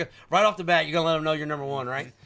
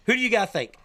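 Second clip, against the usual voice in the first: ordinary talk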